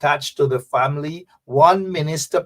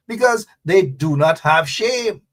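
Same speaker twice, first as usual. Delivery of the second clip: ordinary talk, nothing marked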